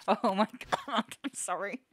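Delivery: ordinary talk, nothing marked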